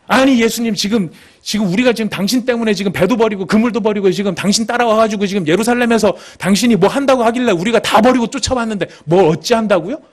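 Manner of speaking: high-pitched, run-on protest; indignant rebuke as Peter. Acted. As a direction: pile up outraged questions, peaking at the end. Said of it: "베드로가 예수님을 막 꾸짖는 거예요". (따지며 꾸짖듯 격앙되게)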